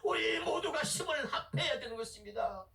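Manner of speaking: full-force preaching, pitched high and sustained. Acted. Preacher summoning God's servants to speak up, fervent preaching. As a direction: call out to the congregation like a herald.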